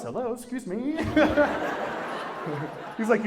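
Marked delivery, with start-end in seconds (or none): 0.0-0.9 polite doorstep voice. Pretend. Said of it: "You imagine me showing up".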